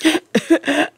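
short, high choking gasps; strangled gasps. A narrator's reading. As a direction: gasp in bursts as if strangled. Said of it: "a series of strangled gasps".

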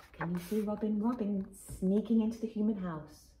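ordinary delivery

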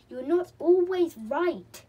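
loud, high, slow retort; obstinate. A narrator's reading. Molly argues back at Chinky, obstinately. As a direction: dig your heels in, storybook style. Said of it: "said Molly obstinately".

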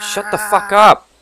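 angry outburst. Acted. Stranger loses it at the screaming local, furious shout. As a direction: snap to silence them.

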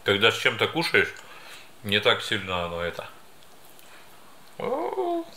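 talking while biting food; words muffled by meat chunk; mouth full of meat. Real, not acted. Seen 0: Holds pork while talking, then bites; chunk hangs from mouth.

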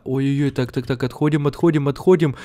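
ordinary talk, nothing marked

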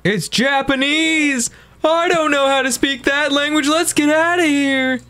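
high, panicked voice; shrill panicked squeal. Real, not acted. Panicked at ghost in horror game.

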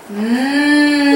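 long, drawn-out hum of eating; slow sustained mmm; yummy mmm. Shown as a demonstration. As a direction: hum as if tasting something good. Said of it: "Can you say it?".

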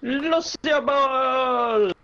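high, long drawn-out announcer cry; sports announcer call. Pretend. Player enjoys saying the game's name, drawn-out announcer call. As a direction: stretch the title out like a stadium commentator.

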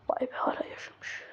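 hushed whispered aside; whispered amazement. Pretend. Speaker marvels to himself at an absurd family tale, whispered. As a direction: whisper it, barely any voice.

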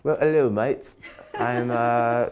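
high, loud mock British greeting, laughing through it; clumsy fake Cockney hello; bad British accent. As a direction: ham up a terrible English accent. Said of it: "That was terrible".

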